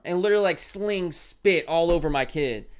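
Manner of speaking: ordinary talk, nothing marked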